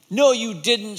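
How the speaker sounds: loud, high snappy retort; snapping. Acted. Boy snapping at the monster. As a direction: snap back at the monster. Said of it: "snapped Sam".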